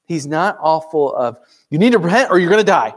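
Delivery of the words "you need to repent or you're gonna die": fast, louder mock hellfire warning; harsh warning. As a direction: rattle off a stern threat, half mocking. Harsh preaching voiced as quote.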